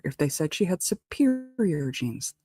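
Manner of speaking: superior drawn out slowly and lifted high; stress on superior. Shown as a demonstration. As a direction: lean long on the word superior. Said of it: "emphasis on the word superior".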